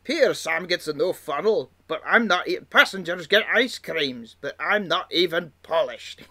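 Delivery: lightly voiced sulky engine complaining; mock-grumbling engine quote; grumpy Duncan. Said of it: "it was".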